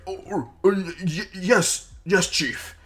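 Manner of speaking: high, stammering, obedient underlings; cowed goblins. Acted. Goblins fall in line before the chief, high nervous stammer. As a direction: stammer it out high, eager to obey. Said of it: "all the other goblins kinda fall in line".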